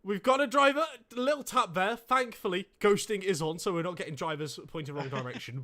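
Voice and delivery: loud, high excited commentary. Acted. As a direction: burst out over the crash.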